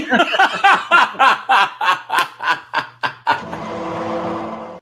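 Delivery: long, high cackle that slowly dies away; high, rattling villain-style laugh; manic cackle. Acted. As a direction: cackle on and on, then fade. Cackles after own parody.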